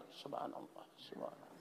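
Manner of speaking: barely audible, whispery repeated praise; murmured dhikr. Acted. Cook grinding chili by hand, murmuring dhikr while working. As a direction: repeat it under the breath, very softly. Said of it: "membaca subhanallah sadang manggiliang".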